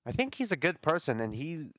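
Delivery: usual speaking voice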